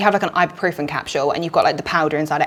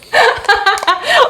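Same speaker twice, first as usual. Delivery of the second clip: big laugh; 13 semitones higher, 4 dB louder than usual. Real, not acted. Laughing at the quiz answer.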